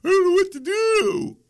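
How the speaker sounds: high, put-on whine of someone at a loss; squeaky mock despair; whiny helpless voice. Mock quote of a helpless person.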